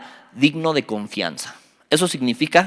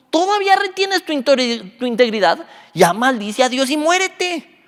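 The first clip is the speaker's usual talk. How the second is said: scolding wife in a raised pitch; Job's exasperated wife; 10 semitones higher than usual. Acted. Wife telling suffering Job to curse God, high-pitched scolding. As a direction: berate him, drawing out the final curse.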